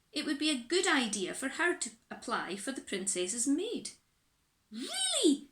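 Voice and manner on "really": a giddy, startled squeak; high squeaky surprise. Acted. Little Miss Scatterbrain squeaks her surprise. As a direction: shoot way up in pitch on really. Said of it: "said Little Miss Scatterbrain".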